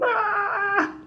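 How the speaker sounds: high, drawn-out wail; acted pain scream. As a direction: imitate a labor scream. Re-enacts screaming on arriving at hospital.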